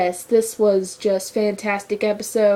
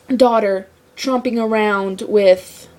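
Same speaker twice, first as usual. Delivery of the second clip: ordinary talk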